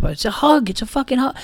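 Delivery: put-on high whiny drunk shrug; shrugging drunk. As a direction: whine it with a shrug. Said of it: "typical drunk guy shrugging their shoulders".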